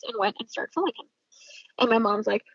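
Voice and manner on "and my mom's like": usual speaking voice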